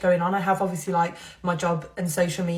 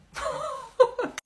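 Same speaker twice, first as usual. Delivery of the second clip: high, squeaky giggle; real laugh. Real, not acted. Vlogger finding Halloween eyeshadow on her brush, laughing.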